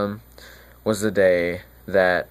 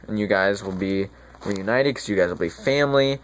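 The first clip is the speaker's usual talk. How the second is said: normal talking voice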